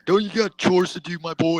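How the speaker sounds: mocking imitation. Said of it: "Why you talk like a homo".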